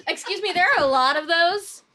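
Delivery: ordinary delivery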